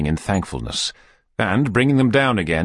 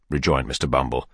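usual speaking voice

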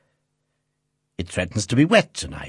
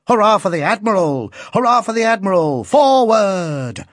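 put-on high, loud boy's battle cry; child voice cheering; 9 semitones higher, 5 dB louder than usual. A narrator's reading. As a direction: lad rallying a charge. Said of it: "cried the boy".

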